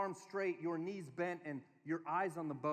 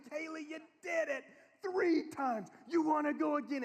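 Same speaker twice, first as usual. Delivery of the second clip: acted over-eager praise; excited dad; 9 semitones higher, 6 dB louder than usual. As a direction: urge the child on with exaggerated pride. Acted dad cheering child on.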